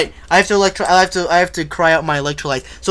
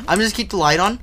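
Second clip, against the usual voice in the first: ordinary talk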